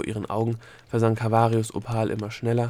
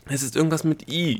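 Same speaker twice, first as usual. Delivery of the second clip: voiced gasp, pitched up; 5 semitones higher, 4 dB louder than usual. A narrator's reading. Delia gasps out her half-remembered word, panting. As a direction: blurt it out as if short of breath. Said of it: "japste Delia".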